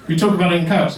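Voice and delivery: ordinary talk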